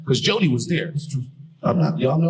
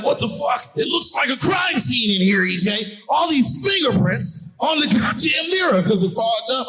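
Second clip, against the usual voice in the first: higher, louder shocked yell; mock horrified yell; 10 semitones higher, 3 dB louder, slower than usual. Roommate yells from the bathroom in mock horror. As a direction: yell it like you found a crime scene. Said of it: "a scream from the bathroom".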